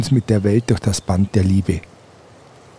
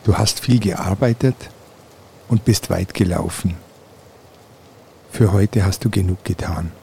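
guided meditation. Acted. Leads a guided relaxation.

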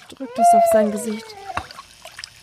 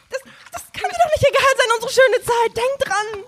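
desperate pleading; 11 semitones higher than usual. Acted. Woman pleading with Bobby.

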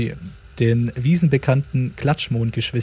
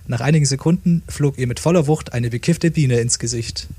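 usual speaking voice

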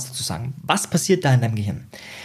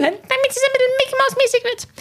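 very high squeaky cartoon voice; Mickey Mouse. Shown as a demonstration. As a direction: jump up into a tiny squeak. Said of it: "die Tonhöhe erhöhen". (extrem hohe Piepsstimme)

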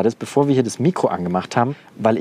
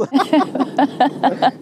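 bright burst of laughter, much higher; 14 semitones higher than usual. Real, not acted. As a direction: laugh out at the lightning. Lacht über Blitz. (hohes Auflachen)

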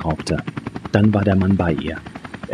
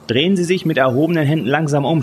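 high-pitched command, moderately raised voice; shouted order; 7 semitones higher than usual. A narrator's reading. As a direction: call the order out over noise. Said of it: "schrie der Mann". (hoch gerufener Befehl)

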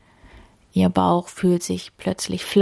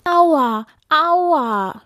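high mock wail of pain; whimpering wail; 10 semitones higher, 5 dB louder than usual. A narrator's reading. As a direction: wail like a hurt little deer. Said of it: "jammerte es und weinte". (gespielt jammernd)

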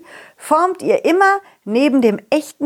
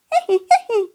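high laugh; 13 semitones higher than usual. Acted. Character laughs.

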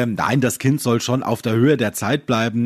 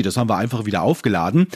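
normal talking voice

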